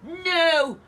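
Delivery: loud, high cry of no. Acted. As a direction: witch crying out "No!" in fright. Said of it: "cried the witch".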